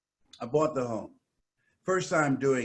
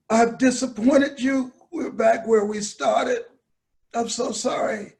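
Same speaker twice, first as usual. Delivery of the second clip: tearful apology; 9 semitones higher, 4 dB louder than usual. Retold or imitated.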